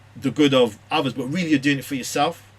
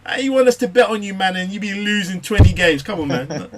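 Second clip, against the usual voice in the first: higher, louder heckling fan voice; mock disappointed fans; 6 semitones higher, 6 dB louder than usual. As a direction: razz him like a fan who lost his bet. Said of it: "they're gonna go".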